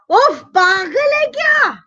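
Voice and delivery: high, fast exasperated yell; mock angry scream. Older brother screaming after Ajay bumped him, high angry yell. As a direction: burst out at him, pitched high. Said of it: "screamed भैया".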